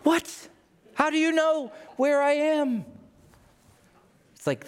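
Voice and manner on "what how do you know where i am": high startled yelp of disbelief; pitched-up mock panic; 1 shocked. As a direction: squeal out the what in alarm. Shocked reaction to Amazon ad.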